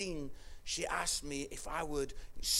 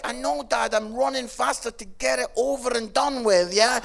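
loud, high imitation of a fed-up daughter; exasperated daughter; 8 semitones higher, 11 dB louder than usual. Daughter setting dad straight about running, exasperated. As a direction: protest loudly as the daughter. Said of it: "She's like".

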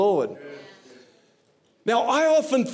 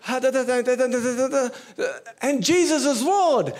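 imitating a trembling young preacher rushing her words, then landing the phrase; mock nervous preaching; 4 semitones higher than usual. The pastor imitates Sachi preaching nervously to the college, in a higher, shaky voice.

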